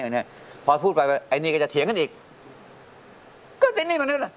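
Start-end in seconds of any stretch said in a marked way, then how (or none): 2.9-4.3 put-on high, slow whining kid voice; high-pitched child's retort, mimicked; whiny child. Father mimics quarreling child.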